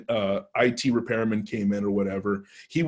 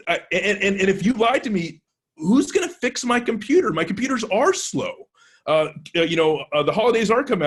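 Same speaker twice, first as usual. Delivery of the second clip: much higher, aggrieved whining complaint; retold outrage; 9 semitones higher, 3 dB louder than usual. Worker scolding testers who lied to him, high aggrieved whine. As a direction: whine like a wronged man who needs his computers fixed. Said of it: "He was angry".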